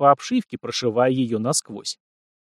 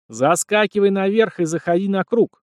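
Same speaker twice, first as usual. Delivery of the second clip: higher, louder shouted command; shouting; 7 semitones higher, 3 dB louder than usual. A narrator's reading. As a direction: call it out over the rotor noise. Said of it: "крикнул Март пилоту".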